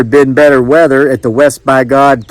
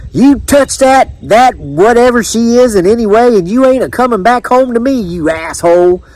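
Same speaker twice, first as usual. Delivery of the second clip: high-pitched angry country wife; threatening wife; 9 semitones higher than usual. A narrator's reading. Judith warning Jethro off the kiss seller, high angry threat. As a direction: warn him off, high and indignant.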